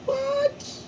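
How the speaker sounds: sudden squeak way up high; squeaky what. Pretend. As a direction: yelp it in a tiny high voice. Squeaky exclamation after [name].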